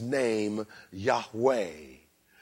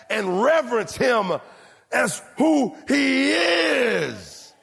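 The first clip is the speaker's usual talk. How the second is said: preaching at full force; 6 semitones higher than usual. Acted. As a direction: build the congregation up, pounding each name. Preaching climax on God's name.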